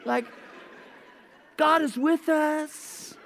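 soft, slow, soothing words to a partner; calm reassurance. Retold or imitated. Husband soothes his stressed wife, soft and slow. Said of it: "I'm like".

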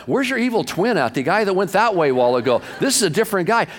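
high-pitched retold bafflement; incredulous. Driver marvels at the suddenly kind officer, incredulous. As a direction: play up the disbelief at the switch.

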